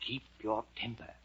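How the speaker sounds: soft, grave advice; earnest counsel. Acted. Storyteller to child, always remember it, soft and earnest. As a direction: offer it quietly and sincerely.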